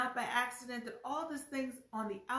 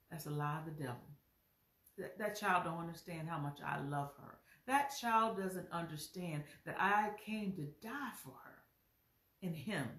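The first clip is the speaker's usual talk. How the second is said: tender reassurance; 5 semitones lower than usual. Acted. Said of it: "God is listening to you saying".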